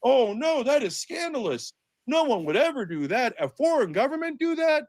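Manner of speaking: high, wide-eyed gee-whiz innocent voice; wholesome 1950s kid. Retold or imitated. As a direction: play a naive little kid, mocking the media. Sarcastic mock innocence about Epstein.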